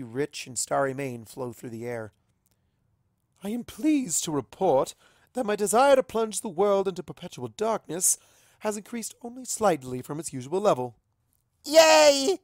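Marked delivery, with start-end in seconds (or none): 3.4-11.0 higher, louder, mock formal report; deadpan royal announcement; dry regal joke. Luna joking to the group, mock formal. 11.6-12.4 very high, loud cheer. Acted. Pinky cheering at Luna's joke, loud. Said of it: "shouts Pinky".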